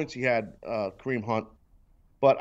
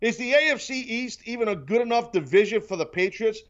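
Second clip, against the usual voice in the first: mocking pundits; 6 semitones higher than usual. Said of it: "all you hear on sports radio".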